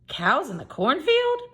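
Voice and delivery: a surprised Tom spinning round; startled question. A narrator's reading. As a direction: blurt it, startled.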